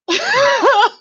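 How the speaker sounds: a quick, high burst of laughter; high giggle. Real, not acted. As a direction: giggle at the chat message. Laughs at Aquarius comment.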